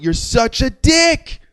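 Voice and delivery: very high, slow, incredulous jeer; laughing disbelief. Retold or imitated. Telling off the driver who took both spots, incredulous. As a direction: stretch it out in amused astonishment. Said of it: "I was laughing. I went".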